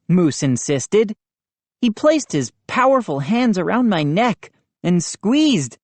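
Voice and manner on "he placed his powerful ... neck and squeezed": ordinary delivery, nothing marked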